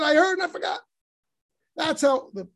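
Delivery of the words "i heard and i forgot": emphatic quote. Retold or imitated. Teacher voicing Moses' admission.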